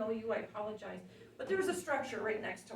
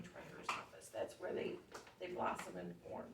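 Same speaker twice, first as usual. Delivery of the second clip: hoarse voice fading to a whisper; raspy whisper; 3 semitones lower, 8 dB quieter than usual. Real, not acted. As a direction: voice giving out, barely voiced. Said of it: "Sorry, I'm losing my voice".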